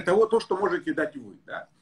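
ordinary talk

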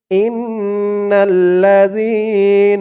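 slow, melodic tajweed recitation; slow Quranic chanting. Shown as a demonstration. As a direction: chant each word long and drawn out. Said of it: "তো বলুন আমার সাথে".

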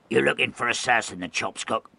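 rough sailor's croak, lightly voiced. A hint of old sailor threatening the gawping landman. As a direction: growl a threat at the staring lad. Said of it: "he croaked in a grog-ravaged voice".

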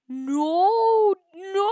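high, loud, drawn-out cries; hammed-up cry of dismay; wailed double no. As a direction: howl each no, sliding upward. Attacked by player in game.

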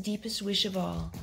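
plain reading voice

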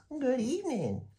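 a dainty monster greeting politely; high silky voice. A narrator's reading. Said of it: "he said in a high, silky voice".

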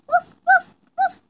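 high, loud yappy woofs; dog barking. Acted. The pet dog barks at its owner, yappy and loud. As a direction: bark like a small excited puppy.

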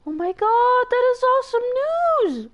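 high, loud thrilled squeal; excited squeal. Real, not acted. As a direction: shriek with real joy. Excited squeal at good news.

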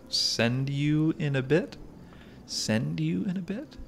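drawn-out question climbing high at the end; high, incredulous-sounding slow query; exaggerated questioning. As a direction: stretch it out, pitch rising into the question. Repeats a line as a rising question.

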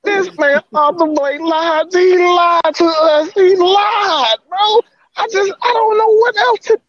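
high, slow, strained wail; crying out that he was lied to; betrayed and upset. Real, not acted. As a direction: heartbroken whine. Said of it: "I'm just so emotional right now".

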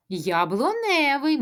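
ordinary talk, nothing marked

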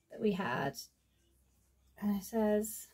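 ordinary talk, nothing marked

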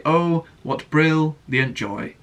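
spoken slowly, "brilliant" broken apart into "brill ... yant"; stilted, drawn-out sentence with "brilliant" split in two; awkward emphasis. Shown as a demonstration. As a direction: say "brilliant" with an unnatural gap in the middle. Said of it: "with that emphasis, it would sound really weird".

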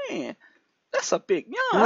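retelling an amazed reaction to a huge yawn; amazed exclamation. Retold or imitated. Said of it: "I said".

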